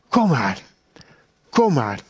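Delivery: low, gentle coaxing; a low, soothing invitation; tender call. Acted. God tenderly calling the hiding person.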